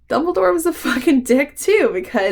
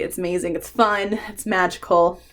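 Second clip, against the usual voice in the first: ordinary talk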